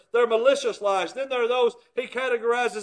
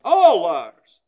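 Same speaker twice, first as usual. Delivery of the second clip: preaching shout; 4 semitones lower, 9 dB louder than usual. Acted. Preaching emphasis.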